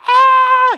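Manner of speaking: piercing high screech of joy; screeching wife. Acted. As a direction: let out a wild high shriek. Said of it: "screeching on the other line".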